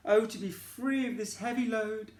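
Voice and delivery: a lightly voiced tired horse longing; weary horse wish. Horse sighing to the unicorn, wishing to gallop. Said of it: "he said".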